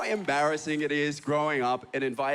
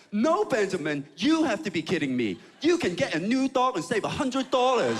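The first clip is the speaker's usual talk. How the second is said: put-on loud, higher scolding mom in a Chinese accent; 5 semitones higher, 3 dB louder than usual. Chinese mom correcting her son over the dog's cost, loud Chinese accent. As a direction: overrule your son in a Chinese mother's accent. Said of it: "do quite a lot is the Chinese accent".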